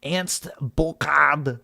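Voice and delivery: very slow mock German accent; exaggerated German, word by word. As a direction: draw out the name in German accent. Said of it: "You said the British accent was racist".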